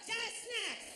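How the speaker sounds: "Just next" squawked high as one mashed word; mimicked lazy "Just next", squeezed and high; slurred counter call. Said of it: "she was barely trying to speak".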